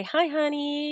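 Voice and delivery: high, soft singsong hello; sweet greeting. Retold or imitated. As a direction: greet him sweetly, pitched well up. Greeting husband with a drink.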